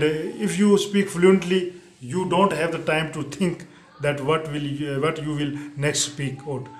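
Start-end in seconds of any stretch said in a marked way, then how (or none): none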